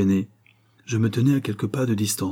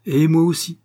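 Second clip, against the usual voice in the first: high, loud interruption; bold interjection; 7 semitones higher, 6 dB louder than usual. A narrator's reading. Countess stepping out from behind the doctor, bold and loud. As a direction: cut in with a ringing voice.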